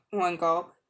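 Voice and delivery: plain reading voice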